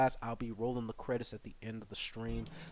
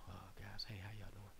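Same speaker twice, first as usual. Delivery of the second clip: hushed greeting; 6 semitones lower, 19 dB quieter than usual. Real, not acted.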